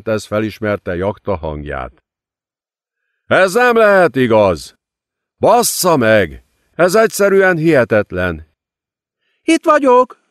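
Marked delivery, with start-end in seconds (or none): 3.3-8.5 louder, disbelieving exclamation with a curse; stunned outcry. A narrator's reading. Jakta calling out, stunned, from afar. 9.4-10.2 very high, louder happy shout; cheerful call. A narrator's reading. Lilith waving to the men, calling cheerfully. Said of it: "kiáltotta vidáman Lilith".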